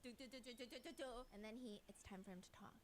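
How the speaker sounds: ordinary delivery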